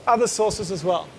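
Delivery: ordinary delivery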